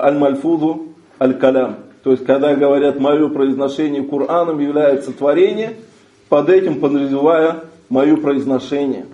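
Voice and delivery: ordinary delivery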